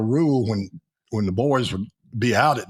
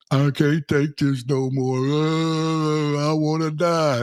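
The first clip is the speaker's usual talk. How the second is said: imitating crying soldier; 5 semitones higher, slower than usual. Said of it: "he was crying".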